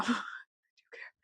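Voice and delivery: breathy whisper confessing she cares; whispered admission. Real, not acted. To the viewers, admitting she cares, in a whisper. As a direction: drop to a secret whisper.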